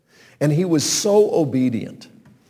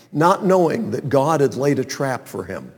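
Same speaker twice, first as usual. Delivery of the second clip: normal talking voice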